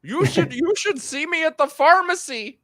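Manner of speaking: high, strained mock voice; badly faked high impression of the other guest; hammed-up high squeaky voice. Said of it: "That was terrible".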